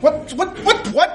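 high, laughing double-take; startled disbelief. Retold or imitated. Shopper spotting the DVD, laughing in high surprise. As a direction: squeal it out in happy shock. Spotting season two in store.

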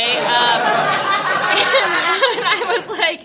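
ordinary talk, nothing marked

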